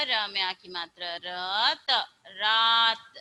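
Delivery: र में आ की मात्रा, then रात said clearly; slow phonics reading. Shown as a demonstration. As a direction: build the word sound by sound. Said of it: "ऐसे करके आपको पढ़ना है". (धीरे-धीरे जोड़कर पढ़ाना)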